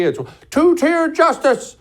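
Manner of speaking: loud, very high-pitched mimicked outcry; mock media outcry. Mimicking outcry all over media.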